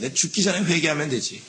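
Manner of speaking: casual shrug of a man delaying repentance; complacent sinner. Retold or imitated. Person who puts off repentance, casually dismissive. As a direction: toss it off lightly, no urgency. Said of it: "이 사람이 있어요".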